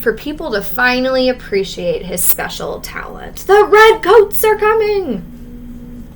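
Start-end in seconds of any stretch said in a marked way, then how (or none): 3.5-6.0 higher, slow, loud rallying shout; Revere's midnight shout; heroic cry. Acted. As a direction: call it out to wake the town. Paul Revere's famous cry.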